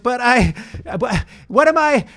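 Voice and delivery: high, loud indignant retort; mock outrage at being replaced; exasperated. Retelling his outrage at the toy, high and loud. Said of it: "And I was like".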